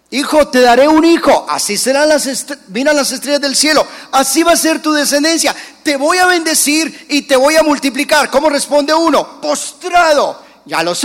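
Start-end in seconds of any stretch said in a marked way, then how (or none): 0.1-8.3 loud, higher, grand promise; booming God. Retold or imitated. God promising Abraham descendants, loud and grand. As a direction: proclaim blessing like a mighty God.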